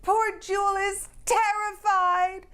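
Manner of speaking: high, worried cry of alarm. Acted. Girl alarmed at what her sister does. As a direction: sound the alarm.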